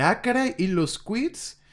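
high, sharp mock outrage then slow; sarcastic exclaim. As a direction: fling it back at him. Eve retorts to angry Mark.

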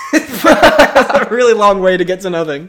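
loud, slow, laughing through it. Real, not acted. Host laughing at the long way to nothing, laughing. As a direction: crack up while saying it. Laughing at failed search for accents.

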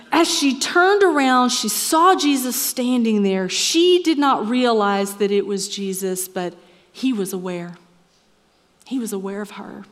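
loud, lifted reading of the gospel; preacher reading John aloud with passion; dramatic scripture reading. Acted. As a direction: proclaim the verses with fervour.

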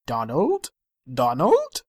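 put-on slow, soothing Welsh accent; calm him down in a Welsh accent. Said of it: "is that your Welsh accent".

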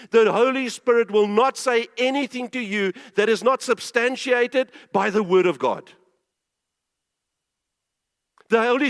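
urgent, forceful pulpit warning; emphatic sermon voice, stressing each clause; preaching. Real, not acted. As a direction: press the point hard on the congregation.

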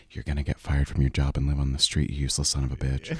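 very low ASMR-style roast; quietly humiliating. Pretend. Comedian pitching humiliation ASMR, quiet and scornful. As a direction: roast them in a deep, hushed murmur. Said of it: "Can I just humiliate people quietly?".

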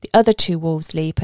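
ordinary delivery

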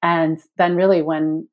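ordinary delivery

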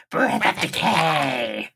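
very slow, strange attack call; odd monster voice. Retold or imitated. Podcaster correcting the Raremon impression, very odd drawn-out voice. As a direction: imitate an odd monster, dragging out each word. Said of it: "It's very odd".

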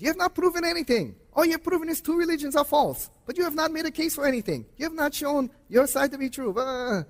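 very high, rapid-fire Middle Eastern caller parody; mock a protesting caller in a fast, high-pitched, badly done Middle Eastern accent. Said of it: "in his Middle Eastern accent, I can't do it very well".

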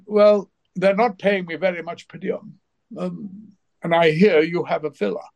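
a hint of a plaintive thespian angling for favours; John Gielgud. Actor angles for a room in colleague's villa, lightly imitated. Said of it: "He said".

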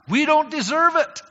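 we don't deserve it, high and loud; emphatic preaching. Real, not acted. Preacher booming at the church, emphatic. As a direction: hammer it home to the congregation.

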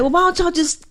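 high-pitched quoted question; retold bafflement. Retells own baffled question.

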